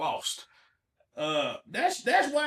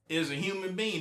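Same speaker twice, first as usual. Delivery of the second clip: ordinary talk, nothing marked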